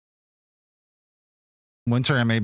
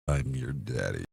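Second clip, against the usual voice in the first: low dad voice; 11 semitones lower, 6 dB quieter than usual. Pretend. Sudden low voice.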